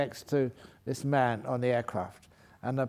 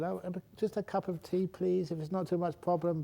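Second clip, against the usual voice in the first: higher, softer polite plea; trying to be polite; 6 semitones higher, 3 dB quieter than usual. Retold or imitated. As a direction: ask sweetly, overly courteous. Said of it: "being trying polite".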